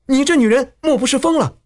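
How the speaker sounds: high, incredulous outcry; crying out. A narrator's reading. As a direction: call out in high-pitched challenge mid-fight. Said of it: "叫道".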